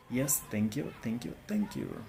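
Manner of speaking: usual speaking voice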